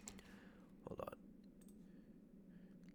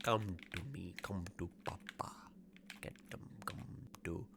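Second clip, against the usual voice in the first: creepy whisper. Pretend. Gamer luring enemies with a creepy whispered Papa Panther. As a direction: lure them in with a hushed creepy voice.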